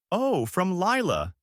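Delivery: high, slow sing-song lilt. Acted. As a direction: sing it out sweetly for the crowd. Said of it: "she said in that sing-song voice".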